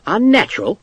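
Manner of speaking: high, startled question; surprised question. A narrator's reading. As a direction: ask it back in disbelief. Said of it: "Stokes asked".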